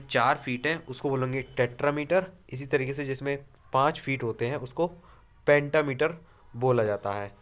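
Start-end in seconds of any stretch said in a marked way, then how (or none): none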